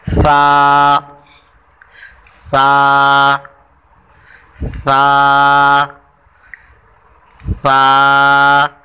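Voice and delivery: सा called loud with tongue on teeth; tha drill, no whistle. Shown as a demonstration. Teacher drilling the class on सा, loud. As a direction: drill सा firmly with no hiss. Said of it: "ज़ुबान ऊपर लगानी".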